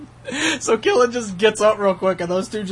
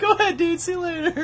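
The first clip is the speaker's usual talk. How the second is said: mock breezy dismissal; 13 semitones higher than usual. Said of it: "you were just gonna be like".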